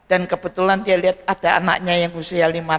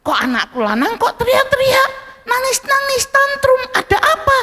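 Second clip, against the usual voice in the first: much higher alarmed mother's voice; startled mother. Acted. Mother in the kitchen hearing her son scream, higher alarmed voice. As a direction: gasp it out like a startled mum. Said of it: "Mamanya kan kaget di dapur".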